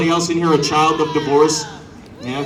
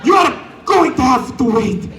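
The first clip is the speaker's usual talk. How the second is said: much higher, louder Italian American rant; over-the-top Italian American; 9 semitones higher, 3 dB louder than usual. Retold or imitated. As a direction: shout like a hammed-up Italian American cook. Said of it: "severe moment of over-Italianing".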